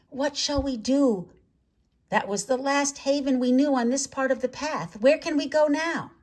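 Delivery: a lost little swarm asking for help; lightly voiced butterfly chorus. A narrator's reading. Butterflies speak in his mind.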